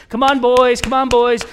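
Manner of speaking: high, loud coach's encouragement; rally the boys from the sideline; Coach Bob. Retold or imitated. Coach urging the boys along, upbeat calling out. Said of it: "the Coach Bob voice".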